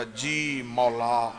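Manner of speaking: soft, low and very slow; humble reply. Acted. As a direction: answer meekly, drawn out. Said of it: "हाथ बांध कर कहा".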